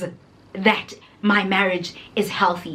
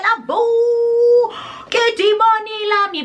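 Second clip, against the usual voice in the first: mock demon alarm; 11 semitones higher than usual. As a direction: ham up the panic. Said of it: "I said".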